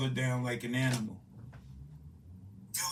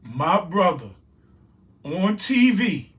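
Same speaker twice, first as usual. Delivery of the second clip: emotional outburst; 5 semitones higher, 11 dB louder than usual. Real, not acted. Grieving brother cries out about the killing in real anguished shouting. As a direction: cry it out in real anguish, pausing between phrases. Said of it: "I'm getting emotional".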